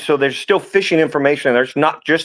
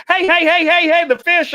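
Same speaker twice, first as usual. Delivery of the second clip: much higher, rapid hey-hey protest; frantic client. Retold or imitated. Client protesting as the guide flees, high frantic squeal. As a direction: squeal it like the catch is getting away. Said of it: "The guy's like".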